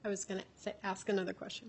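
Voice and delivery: hoarse, voice nearly gone; lost voice. Real, not acted. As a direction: speak with almost no voice. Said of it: "I have no voice today".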